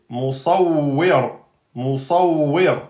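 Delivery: "musawwir" slow, stopping on r; slow model; pausal form. Shown as a demonstration. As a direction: drag "musawwir" and stop on the r. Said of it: "on s'arrête sur un mot en soukoun".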